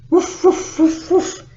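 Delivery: loud, high imitated barks; yappy dog impression; dog woofing. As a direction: woof loudly like a dog. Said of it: "And the dog went".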